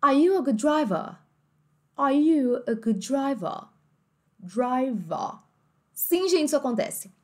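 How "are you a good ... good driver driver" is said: British accent. Shown as a demonstration. Teacher voicing a British family asking a question. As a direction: drop the r, say "driver" as "drivah". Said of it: "com um sotaque um pouco mais britânico".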